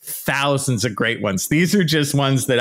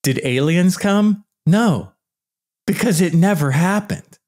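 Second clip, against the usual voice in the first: ordinary talk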